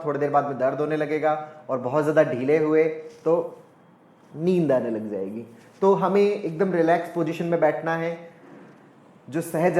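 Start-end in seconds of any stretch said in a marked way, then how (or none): none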